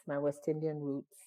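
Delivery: usual speaking voice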